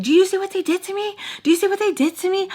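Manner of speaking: high, rushed mock complaint; hammed-up whiny outrage; all dramatic. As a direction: play the wronged victim, fast and high. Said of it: "All dramatic".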